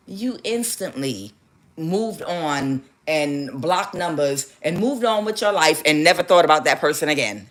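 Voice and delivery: ordinary talk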